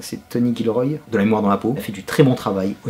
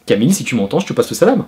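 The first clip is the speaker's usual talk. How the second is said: ordinary talk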